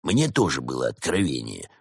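low grumbled retort; gruff low muttering; grumbling. A narrator's reading. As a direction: grumble it under your breath. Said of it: "буркнул Проспер".

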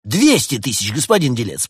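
high, loud demand. A narrator's reading. Summer spirit raises the businessman's price, demanding. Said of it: "потребовал дух лета".

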